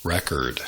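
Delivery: record as REK-erd, the noun; stress on first syllable. Shown as a demonstration. Teacher shows learners the noun, record stressed on first syllable. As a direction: stress the front of record, weak ending. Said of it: "the noun".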